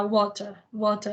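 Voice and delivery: water with a clear British T; British "water", T sounded crisply; British accent. Shown as a demonstration. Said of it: "estudar com o sotaque britânico".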